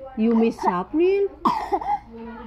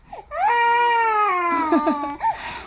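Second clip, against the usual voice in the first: high, long amused laugh; sustained high-pitched laughter; real laugh; 7 semitones higher than usual. Real, not acted. Amused by the crying child.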